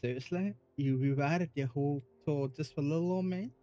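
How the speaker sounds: slow, sugary Southern Belle drawl, lightly done. Retold or imitated. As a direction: mimic a coy Southern Belle. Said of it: "in your Southern Belle voice".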